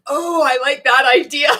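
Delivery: loud, high, laughing approval; laughing delight. Real, not acted. Host cheering a viewer's idea of a dance, laughing delight.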